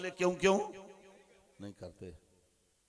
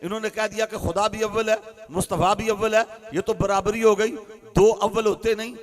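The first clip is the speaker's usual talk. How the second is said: loud, driving sermon with call and answer; fiery preaching; 4 semitones higher, 13 dB louder than usual. Acted. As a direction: preach it hard to the crowd. Preaching at full force. (ज़ोरदार तक़रीर का अंदाज़)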